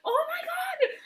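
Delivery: high-pitched gasp of book-reader excitement; retold squeal of amazement; amazed squeal. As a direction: squeal it, reliving the big discovery. Retelling excited reaction while reading.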